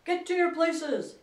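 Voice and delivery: high, loud, slow drawn-out command; queen's booming, imperious yell; thunderous shout. A narrator's reading. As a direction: thunder the order over a crowd. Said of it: "shouted the Queen in a voice of thunder".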